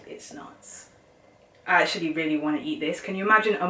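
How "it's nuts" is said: whispered. Real, not acted. Awed aside over food.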